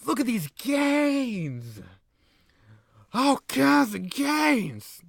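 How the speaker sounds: high, slow, strutting heel voice; cocky wrestler boast. Acted. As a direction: show off the muscles like a cartoon heel.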